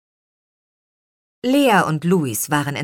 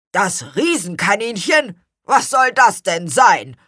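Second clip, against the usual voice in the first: high, louder puzzled exclamation; flabbergasted raised voice; baffled; 5 semitones higher, 5 dB louder than usual. A narrator's reading. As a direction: echo it in bewilderment. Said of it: "rief Gurkenhut verblüfft".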